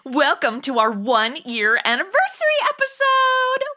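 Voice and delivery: ordinary talk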